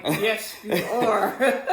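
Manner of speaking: loud, laughing agreement; laughing yes. Real, not acted. Wife laughing along as her husband describes himself.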